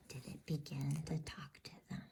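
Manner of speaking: plain reading voice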